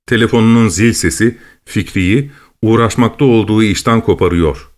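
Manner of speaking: ordinary delivery, nothing marked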